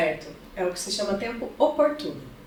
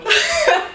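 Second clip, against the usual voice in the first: loud high laugh; 7 dB louder than usual. Real, not acted. Host's guest laughing at her philosophy degree, loud high laugh.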